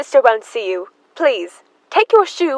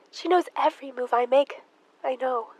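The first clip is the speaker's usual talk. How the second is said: hushed; 9 dB quieter than usual. Acted. Emma hushing maid about her sister.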